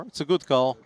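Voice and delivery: ordinary talk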